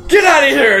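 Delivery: high, loud yell to clear people away; urgent acted warning shout; berserk shouting. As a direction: shout them off frantically. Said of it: "the guy just goes berserk".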